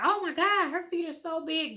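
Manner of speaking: high astonished gasp of a nurse; surprised nurse. Retold or imitated. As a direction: sound amazed at the baby's size.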